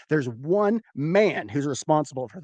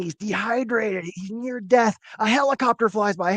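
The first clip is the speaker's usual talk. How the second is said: ordinary talk, nothing marked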